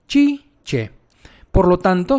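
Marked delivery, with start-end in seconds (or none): 0.1-0.9 Chi, che set side by side, both with a ch sound, che pitched high; Italian chi and che. Shown as a demonstration. Teacher drills the two Italian sounds Chi, che for students. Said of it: "aquí en C E sería che".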